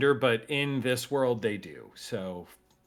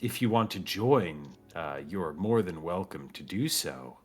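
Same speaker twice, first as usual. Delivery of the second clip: put-on softer, somber old cactus; the elder answers quietly, gravely; 3 semitones lower, 6 dB quieter than usual. Elder invites the party to the ceremony, softly and somberly.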